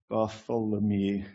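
slow, careful Bartholomew; very slowly. Shown as a demonstration. Preacher showing he must slow down: slow and careful. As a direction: say Bartholomew slowly, each syllable. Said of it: "unless I say it very slowly".

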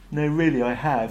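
simple, matter-of-fact robot reply; Marvin, simply. A narrator's reading. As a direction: state it simply, without fuss. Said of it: "said Marvin simply".

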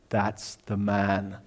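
low, slow, soft ghostly reply; voice from the darkness. Retold or imitated. Hidden voice answering the officer from the dark church, low and eerie. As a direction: answer slowly from the shadows, pitched low.

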